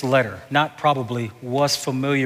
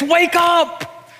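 full-force preaching. Real, not acted. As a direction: preach it out to the congregation.

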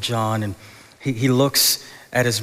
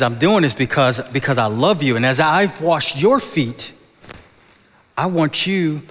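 louder, rising plea from Jesus; earnest Jesus; 4 semitones higher, 4 dB louder than usual. Acted. Jesus telling disciples why he washes feet, louder and earnest. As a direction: proclaim it with fervour, like Jesus to his friends.

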